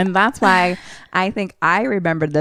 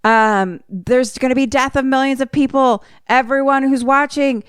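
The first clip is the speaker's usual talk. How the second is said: high, urgent mock yell; acted frantic warning; retold yelling; 7 semitones higher than usual. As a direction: plead higher, as if yelling down the phone. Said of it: "he just is yelling".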